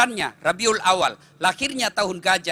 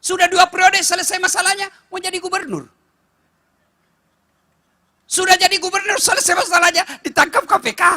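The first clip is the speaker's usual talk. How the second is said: louder, higher preaching voice; full-force preaching; 8 semitones higher, 6 dB louder than usual. Acted. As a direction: preach it out to the crowd. (khotbah berapi-api dengan suara keras)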